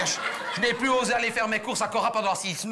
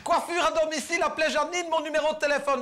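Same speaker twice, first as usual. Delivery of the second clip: quoting it back higher, teasing; mock-quoting the sign; 8 semitones higher than usual. Teasing the neighbour about her roof sign, high mocking quote. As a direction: throw her words back at her. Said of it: "Qu'est-ce que t'as écrit?".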